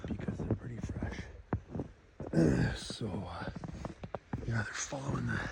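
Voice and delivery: hushed tracker's whisper. Real, not acted. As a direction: breathe the words so wolves can't hear. Moving quietly near fresh tracks.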